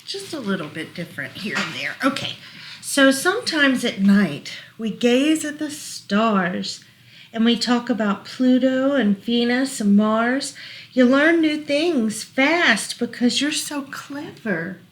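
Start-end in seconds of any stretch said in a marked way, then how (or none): 2.8-14.8 lilting rhyming read-aloud; reading to children. Acted. Parent reading a rhyming book to child, gentle lilt. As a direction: read the rhyme gently to a toddler.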